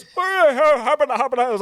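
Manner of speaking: lightly voiced Swedish Chef; Swedish Chef impression. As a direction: do a hammed-up Muppet chef. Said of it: "like the Swedish chef".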